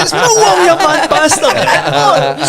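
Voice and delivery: high, slow, laughing put-down; laughing retort. Retold or imitated. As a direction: fire it back while cracking up.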